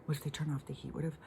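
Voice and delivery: whispered mock panic; panicky whisper. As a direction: whisper it like you're spiraling into worry. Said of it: "if I sit here and go like".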